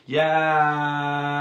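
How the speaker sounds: long, loud, high-pitched drawn-out "yeah"; imitated enthusiastic yell. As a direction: belt out one long held cheer, mimicking a colleague. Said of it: "I believe your response was".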